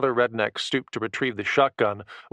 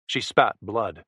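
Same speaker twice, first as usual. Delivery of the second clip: ordinary delivery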